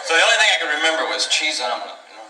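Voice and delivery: ordinary talk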